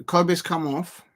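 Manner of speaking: normal talking voice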